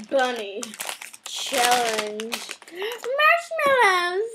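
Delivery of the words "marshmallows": excited. Real, not acted. Excited announcing the candy.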